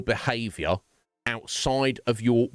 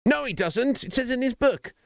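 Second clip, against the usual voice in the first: much higher, hammed-up objector. Streamer mocking Christians who deny God wants gays, in a much higher mock-indignant voice. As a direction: play the indignant believer, pitch up.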